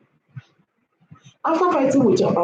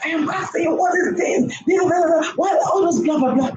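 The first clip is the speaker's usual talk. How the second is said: high, shrill-free mock yell, much higher in pitch; imitating a shouting wife; 7 semitones higher than usual. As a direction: play the nagging wife shouting at her man. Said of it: "if you start shouting".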